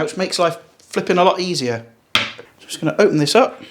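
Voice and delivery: ordinary delivery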